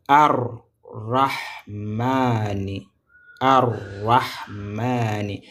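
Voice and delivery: very slow "Ar-Rahmani", every syllable stretched; teacher stretching the word for pupils to copy; drawn-out model pronunciation. Shown as a demonstration. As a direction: hold the rolled r and long "maa" in "Ar-Rahmani". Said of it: "regardez comment je prononce ici".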